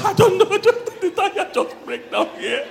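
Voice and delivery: high, loud, laughing wife voice, slowing at the end; mock teary wife. Wife answering her husband, in a high laughing whine. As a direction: play the helpless wife, laughing through it.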